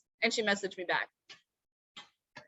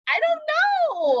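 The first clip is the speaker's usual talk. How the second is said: high, loud, drawn-out mock wail; hammed-up exasperation, slow and high; exasperated wail; 5 dB louder, slower than usual. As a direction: stretch it into a helpless high-pitched cry. Acted helpless answer when pushed.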